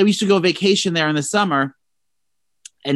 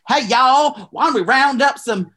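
mock Texas twang, pitched way up; mock Texan accent; 8 semitones higher than usual. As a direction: play a cartoon cowboy from Texas. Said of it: "coming back saying".

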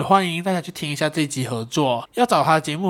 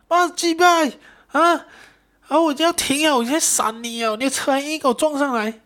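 furious biker yelling; 11 semitones higher than usual. Retold or imitated. Injured rider curses the driver who hit him, furious shouting. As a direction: yell it like the hurt rider cursing you out. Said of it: "那个人就疯狂来骂我".

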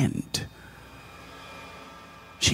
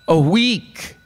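loudly; 7 semitones higher than usual. A narrator's reading. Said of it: "the woman said loudly".

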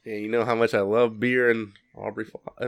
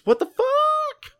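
high, very slow and drawn out in exaggerated disbelief; mock outrage; much slower than usual. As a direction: ham up the reviewer's shock. Acting out an angry reviewer.

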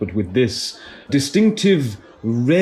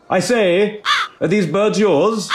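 high posh hail; 9 semitones higher than usual. Acted. Tour guide hails a bird-man.

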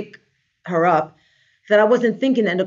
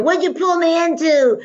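higher-pitched imitated protest; dismayed complaint; 7 semitones higher than usual. Quoting her client calling the next day, mock dismay. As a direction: mimic an aggrieved client.

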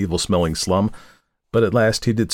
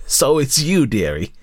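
put-on high, wheezy old crone voice; crafty old woman greeting; wheezing old woman; 8 semitones higher, 3 dB louder than usual. A narrator's reading. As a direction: wheeze it out like a slum hag. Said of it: "she said in a wheezing voice".

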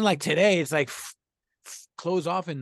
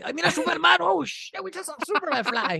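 high-pitched amazed bystander; excited onlooker; 11 semitones higher than usual. Acted. Onlooker seeing Superman fly overhead, excited. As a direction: spot a wonder in the sky, voice up high.

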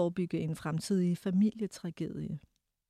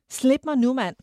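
furious shout, much louder and higher; 6 semitones higher, 10 dB louder than usual. A narrator's reading. Narrator yanking her arm free from Herr Jægerne, shouting. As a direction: yell it to break free. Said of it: "havde jeg råbt meget højt".

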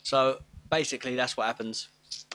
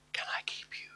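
very soft, breath-only whisper; creepy-sweet whisper; 12 dB quieter, faster than usual. Acted. Casper asking to keep someone, creepy whisper. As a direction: whisper it, eerie but meant to be nice. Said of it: "kind of creepy thing".

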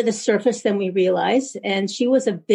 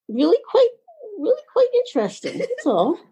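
high dreamy spirit voice; 11 semitones higher than usual. Acted. Doris finishing her answer.

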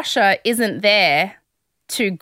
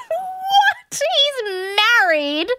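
incredulous; slower than usual. Real, not acted. Shocked she picked married man.

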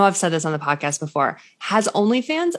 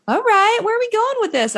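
much higher, wary and disbelieving; suspicious squeak. Retold or imitated. Reading a recruiting email, high suspicious squeak. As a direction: pitch it way up, suspicious.

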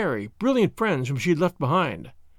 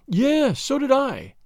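high, lightly sighed agreement; mild wistful sigh in the words; 8 semitones higher, slower than usual. A narrator's reading. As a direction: let it fall away like a sigh. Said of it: "she said with a sigh".

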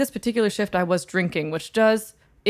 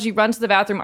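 normal talking voice

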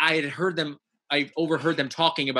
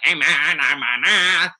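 alien greeting; 2 semitones higher, 5 dB louder, slower than usual. Acted. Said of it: "I had to be like".

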